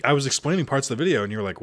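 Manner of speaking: ordinary talk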